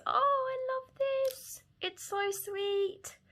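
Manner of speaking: high, adoring squeal; adoring coo. Real, not acted. Crafter admiring gifted stamp, high adoring coo.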